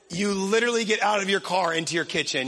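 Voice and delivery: usual speaking voice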